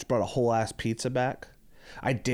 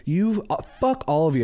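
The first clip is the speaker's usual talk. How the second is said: higher, louder mock outrage; mock anger; 5 semitones higher, 4 dB louder than usual. Retelling a rant at fake Rams fans, mock angry. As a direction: act fed up with the whole crowd. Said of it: "now I'm getting mad".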